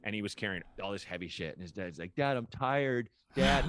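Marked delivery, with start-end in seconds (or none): 2.2-3.7 a light imitation of a tired kid's whine; mildly mock-whine the complaint to dad; kid whining. Small kid to dad in the corner store, mock whining. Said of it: "like, whining".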